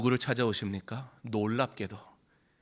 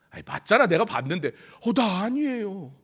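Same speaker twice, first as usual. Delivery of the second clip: high, loud back-and-forth of accuser and denier; mock quarrel; 10 semitones higher, 7 dB louder than usual. Bystander and Peter argue, high and loud. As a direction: play both sides of the bickering. Acted exchange of accusation and denial.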